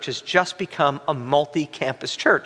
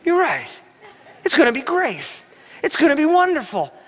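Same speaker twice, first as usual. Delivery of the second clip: much higher, eager quick agreement; mock reassured; 10 semitones higher, faster than usual. Pastor won over by his friend, lightly hammed-up eager agreement. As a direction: bounce back into hammed-up cheer. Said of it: "here's what I'm doing".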